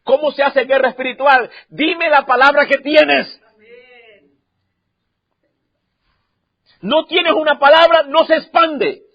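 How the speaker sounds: loud, high preaching; fiery preaching. Acted. Pastor preaching to church at full force. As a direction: preach it hard to the congregation.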